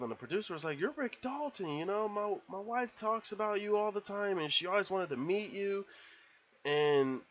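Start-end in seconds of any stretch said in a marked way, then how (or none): 0.8-5.9 higher, mildly excited retelling; starstruck neighbor. Retold or imitated. Producer meeting Rick, a touch of starstruck excitement. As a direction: lift the voice like a fan.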